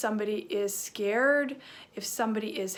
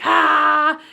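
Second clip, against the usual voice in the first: loud, higher goofy "aah"; crazy silly yell; 5 semitones higher, 11 dB louder than usual. Shown as a demonstration. Teacher showing kids silly faces, crazy yell. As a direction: let out a wacky open-mouthed cry. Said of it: "Kind of crazy".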